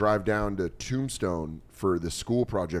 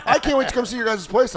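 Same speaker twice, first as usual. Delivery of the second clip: much higher, laughing mom voice; imitating mom; 12 semitones higher, 5 dB louder than usual. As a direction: gush like an eager mother. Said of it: "My mom was like".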